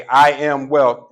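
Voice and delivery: with your chest. Shown as a demonstration. Showing the crowd how to declare I am wealth, loud and slow. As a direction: say it like you mean it. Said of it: "say it with your chest".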